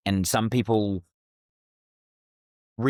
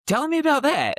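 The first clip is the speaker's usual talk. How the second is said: high, keen prompt to go on; acting out lively curiosity, pitch leaping up; eager interest; 4 dB louder than usual. Fundraiser drawing out a lady about her nephew, eagerly curious. Retold eager follow-up question to householder.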